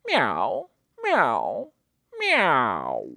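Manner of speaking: drowsy meows fading out; sleepy cat meow. Acted. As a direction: meow like a cat too tired to move. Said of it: "much too tired".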